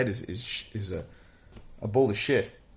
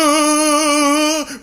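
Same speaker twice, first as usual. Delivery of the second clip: high, loud hammed-up battle yell; mock war cry; 14 semitones higher, 8 dB louder than usual. Mocking the tongueless guy charging, a dramatic mock yell. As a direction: charge yelling, all dramatic. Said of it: "this motherfucker with no tongue is like".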